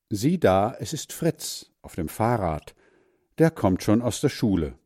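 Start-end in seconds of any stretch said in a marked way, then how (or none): none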